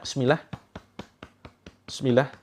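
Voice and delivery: ordinary talk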